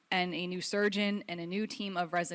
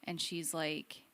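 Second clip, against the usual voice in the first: ordinary talk, nothing marked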